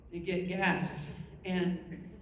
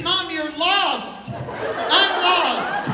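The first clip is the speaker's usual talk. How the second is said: loud, high wail, drawn out; hysterical wail; 7 semitones higher, 6 dB louder, much slower than usual. Retold or imitated. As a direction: wail it in comic despair. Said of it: "a hundred percent hysteria".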